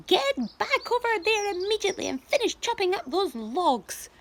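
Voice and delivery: high, loud, bossy aunt; ordering. A narrator's reading. Aunt Spiker ordering James back to the logs. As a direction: snap the order down at him. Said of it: "she ordered".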